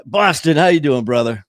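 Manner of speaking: loud, high-pitched hail; sudden loud shout-out mid-story; calling out a greeting. Real, not acted. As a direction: call out heartily to a buddy. Breaks off to greet someone.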